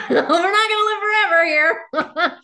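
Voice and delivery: high, loud laugh breaking into the words; real laughter catching in the throat; laughing through tears. Real, not acted. Laughing emotionally about mortality.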